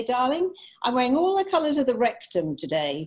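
terribly posh. Retold or imitated. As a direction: imitate a grand, posh friend. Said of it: "She's terribly posh".

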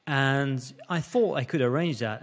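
normal talking voice

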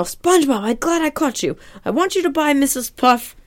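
high, eager cartoon crab voice; Mister Krabs. Crab boss sends SpongeBob shopping, high put-on voice. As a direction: catch him urgently in a high put-on voice. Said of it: "And it's just like".

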